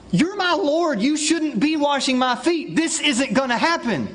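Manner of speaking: earnest Peter. Acted. Peter protests to Jesus.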